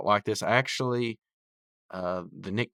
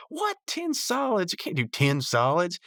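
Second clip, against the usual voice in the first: very high mock outrage; shocked protest; 10 semitones higher than usual. Mordecai to Rigby over the favors owed, high mock shock. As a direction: imitate squawking disbelief at a steep price.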